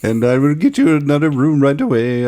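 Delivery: hammed-up Indian clerk apologizing; Indian accent. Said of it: "in the Indian voice".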